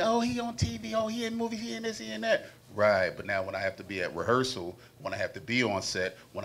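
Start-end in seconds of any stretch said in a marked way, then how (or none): 0.0-2.4 high, gushing mock praise; mocking admirers. Man mimics people gushing about his fame, high and breathless. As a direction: swoon over him like a fan. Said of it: "And it sounds good when you".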